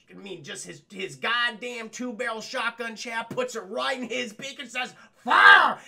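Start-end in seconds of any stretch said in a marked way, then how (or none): 5.2-5.8 sudden high shouted command; yelled fire. Retold or imitated. Daffy yells as he shoots himself, loud shout. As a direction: bark it out as he pulls the trigger. Daffy fires gun in his beak.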